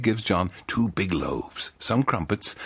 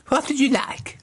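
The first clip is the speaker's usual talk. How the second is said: high squeaky shopkeeper; high mock shop voice; 3 dB louder than usual.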